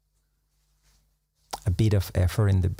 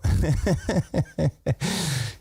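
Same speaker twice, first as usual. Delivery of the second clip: high rueful laugh; 8 semitones higher than usual. Real, not acted. Laughing at parents' respect.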